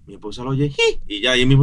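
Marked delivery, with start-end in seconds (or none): 0.7-1.0 tiny high-pitched mock gasp; respingo de susto imitado. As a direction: imitate an alarmed mother's squeak. Imita a la esposa alarmada al oír. (respingo agudo imitado)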